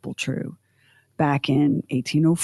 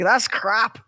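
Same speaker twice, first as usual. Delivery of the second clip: higher-pitched mock indignation of a Scot; indignant Scots; 4 semitones higher than usual. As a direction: voice a disgusted Scot, no real accent. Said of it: "I wish I could do a Scottish accent because the Scots were like".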